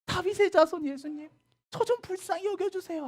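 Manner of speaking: high, loud shouted begging from a distance; mother calling high and loud to Jesus from afar; loud plea. Acted. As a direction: shout for mercy over the crowd. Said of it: "하면서".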